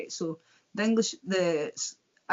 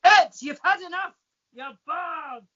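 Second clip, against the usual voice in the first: barman shouting; 9 semitones higher, 8 dB louder, slower than usual. Retold or imitated. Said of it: "they're like".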